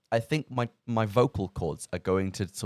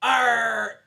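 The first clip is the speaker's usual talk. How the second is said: high, loud pirate cry of Arr!; pirate shout; 12 semitones higher, 6 dB louder than usual. Pretend. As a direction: bellow Arr! like a pirate captain. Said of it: "do me pirate accent".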